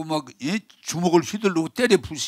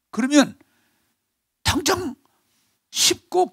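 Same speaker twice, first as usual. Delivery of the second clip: rising cry; 12 semitones higher than usual. Acted. Preacher builds to climax.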